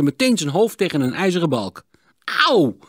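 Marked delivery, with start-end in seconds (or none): 2.5-2.8 korte, hoge, luide auw; gespeelde pijnkreet. A narrator's reading. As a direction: roep alsof je je hoofd stoot. Stoot zijn hoofd. (luide pijnkreet, voorgelezen)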